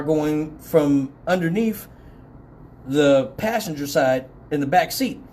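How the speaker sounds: ordinary talk, nothing marked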